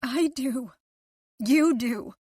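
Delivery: accusing. A narrator's reading. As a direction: throw it back at him.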